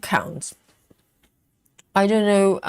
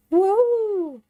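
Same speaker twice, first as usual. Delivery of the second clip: excited; 14 semitones higher than usual. Real, not acted. Zoom finally works on stream.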